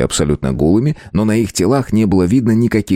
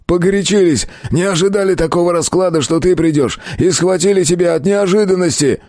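higher-pitched defensive whine; pleading captive thug; 11 semitones higher than usual. A narrator's reading. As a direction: make excuses in a strained high voice. Gang captive pleads his case. (пленный бандит оправдывается, высоким голосом)

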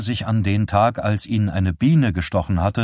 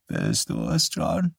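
put-on frail old mother; 9 semitones lower, 4 dB quieter than usual. Dying mother asks if her son is there, faint and wavering. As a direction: ask weakly, unsure who is there.

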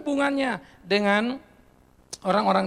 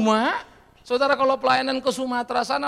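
ordinary delivery

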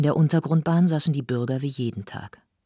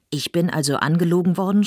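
loud, higher outraged shout; 5 semitones higher, 7 dB louder than usual. Acted. Zilenziger shouts at the cast about being lied to, outraged. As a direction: yell it in outrage. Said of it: "schrie Zilenziger".

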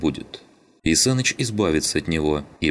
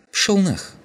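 curt, high-pitched dismissal. A narrator's reading. Skinhead brushes off the man scolding him, curt. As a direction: tell him off in one short burst. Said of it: "коротко ответил скинхед".

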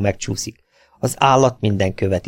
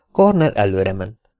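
usual speaking voice

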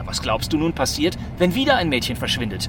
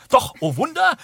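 mock wonder; 10 semitones higher, 3 dB louder than usual. Dramatic announcement.

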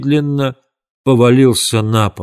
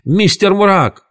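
high-pitched alarmed calling; high, stretched warning cry; cried out; 8 semitones higher, much slower than usual. A narrator's reading. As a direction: call the names out high and urgently. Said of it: "закричал Том".

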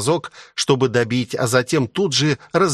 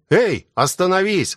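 higher-pitched call to stop; mild exclamation, pitch raised; exclaiming; 7 semitones higher than usual. A narrator's reading. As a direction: call out after someone running off. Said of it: "воскликнул я".

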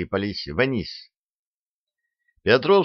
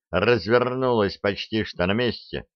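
plain reading voice

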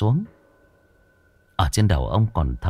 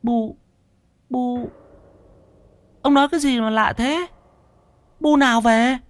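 much higher, puzzled wife's voice; baffled wife. A narrator's reading. Wife baffled by husband's talk of mother, high and puzzled. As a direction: question him in a shrill-free high bewildered tone.

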